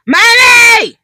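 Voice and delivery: high loud shout; kid calling. Retold or imitated. Kids calling mom again, shouting. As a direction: call mommy at full volume. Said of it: "Two seconds later".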